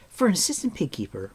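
put-on high, quick girl's voice; Eilonwy. A narrator's reading.

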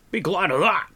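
loud, high, irritable retort; snapping. A narrator's reading. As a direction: snap back as a cross dwarf. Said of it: "snapped Dolly".

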